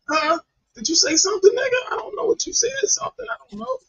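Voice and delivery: high-pitched baffled voice; exaggerated high puzzled imitation; mock confusion. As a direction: squeak it out as if you can't hear a word. Mocking inability to hear low talker.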